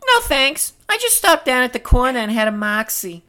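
very high falsetto; high-pitched comic character; Marie's squeaky voice. Acted. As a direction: play it in a put-on squeak. Marie answers Dora in script.

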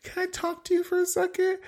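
a hint of fake-nice Teresa; sweet mock Teresa. Imitating Teresa pulling Kathy aside, mock sweet. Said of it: "Teresa's like".

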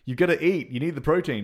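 imitating an exasperated nag; mock telling-off. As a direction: have a go at him, playfully. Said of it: "has a go at him".

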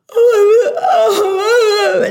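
high, loud panicked wails; freaking out. Retold or imitated. Employee on the phone during a house fire, panicked high wailing. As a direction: shriek it like the house is burning down. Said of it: "She was totally freaking out".

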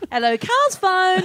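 high, slow, drawn-out phone greeting; mock phone answering. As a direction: play a silly receptionist picking up. Mocking Carl's phone greeting.

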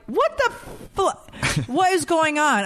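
very high-pitched bewildered indignation; mock outrage. Mom whose son cries to Dad instead, mock outrage. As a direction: squeak it out, comically affronted.

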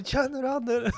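very high imitated voice; put-on high, urgent questioning; agitated question. Retold agitated man asking.

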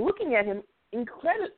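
ordinary talk, nothing marked

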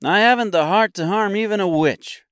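high-pitched character voice; high, earnest tin man's confession; Tin Woodman. A narrator's reading. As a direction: speak up high as the tin man.

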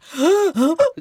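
loud, high gasp of shock; hammed-up gasp of sudden panic; mock shocked gasp. Passenger thinks he sees angels, acted shocked gasp. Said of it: "så han var ju så här".

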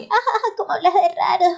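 mocking teen tease. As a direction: tease the new kid's odd accent. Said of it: "nosotras dos como que". (burla aguda de colegiala)

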